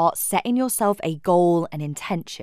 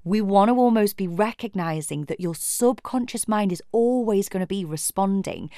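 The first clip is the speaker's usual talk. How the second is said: ordinary talk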